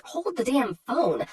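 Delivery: ordinary delivery, nothing marked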